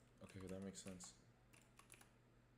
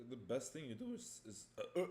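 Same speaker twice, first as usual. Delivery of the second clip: voice crack; 6 semitones higher than usual. Real, not acted. Streamer giving chat advice, voice cracking. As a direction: pitch jumping up as the voice cracks. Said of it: "I got a voice crack".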